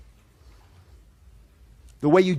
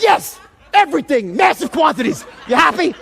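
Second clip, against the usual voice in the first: loud, high sarcastic snap; pissed off; 8 semitones higher, 5 dB louder than usual. Retold or imitated. Caller shouting sarcastic answers at the agent, loud and angry. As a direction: throw the answer back in her face. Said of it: "Now I get really pissed".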